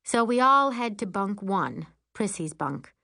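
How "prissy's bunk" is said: ordinary delivery, nothing marked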